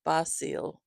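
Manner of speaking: Basil said bah-SEEL; Basil with an open 'ah' and a long 'ee'; corrected pronunciation. Shown as a demonstration.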